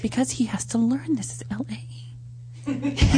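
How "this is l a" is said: whisper. Acted. Mock warning to intern.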